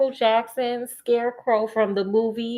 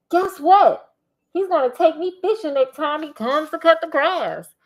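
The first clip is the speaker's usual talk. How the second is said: put-on eager boy, higher and louder; excited; 6 semitones higher, 4 dB louder than usual. A narrator's reading. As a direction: blurt out the news. Excited boy in story read.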